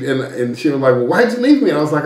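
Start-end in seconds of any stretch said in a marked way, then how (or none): 0.9-1.7 high-pitched mock indignant question; imitated reproach. Wife to husband who swam off without her, mock reproach. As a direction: demand an answer, imitating an upset wife.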